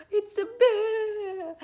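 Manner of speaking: high, loud, stretched storybook shriek; playful panicked shout; mock-scared exclamation. Shown as a demonstration. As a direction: cry out the reveal in pretend alarm. Climax of the bear story.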